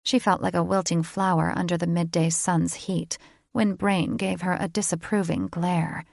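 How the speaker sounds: ordinary delivery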